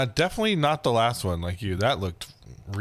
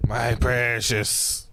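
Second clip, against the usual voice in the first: very slow, drawn-out creature voice; amateur fantasy-creature impersonation, lingering on the words; Gollum; much slower than usual. Acted. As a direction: stretch each word out as a creature impression. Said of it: "That's my Gollum impersonation".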